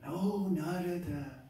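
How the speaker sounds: high, slow, drawn-out delight, then a gasp; awed cobbler greeting. Acted. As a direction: greet a revered sage in wonder. Humble cobbler greets the sage.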